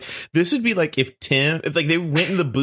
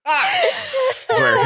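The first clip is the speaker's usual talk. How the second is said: mock voice; much slower than usual. Joke in high voice.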